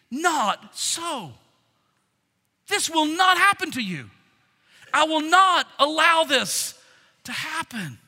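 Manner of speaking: loud, high, emphatic protest; vehement refusal. Acted. Peter refusing to let Jesus be killed, vehement. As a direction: forbid it firmly, leaning on each word.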